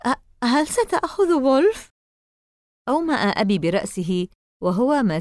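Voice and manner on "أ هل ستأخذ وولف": put-on a high, shaky boy's plea; trembling voice. A narrator's reading. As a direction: ask again, voice trembling with fear. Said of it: "بصوت مرتعش". (سؤال بصوت مرتعش وعالٍ)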